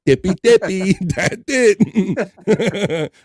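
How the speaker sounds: high, loud, laughing crackhead Bob impression. Host doing crackhead Bob for his co-host, high and laughing. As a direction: cackle through it as crackhead Bob. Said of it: "crackhead Bob impersonations".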